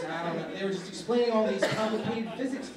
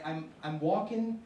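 ordinary talk

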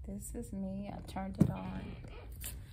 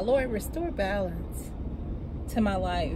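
quiet, earnest prayer; a tired woman quietly praying for balance; 3 semitones lower, 6 dB quieter than usual. Real, not acted. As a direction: pray it softly and sincerely. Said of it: "Let me pray now".

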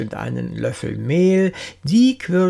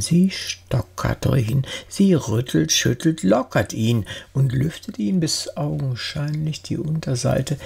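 plain reading voice

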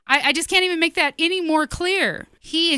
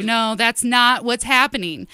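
usual speaking voice

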